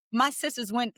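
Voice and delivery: usual speaking voice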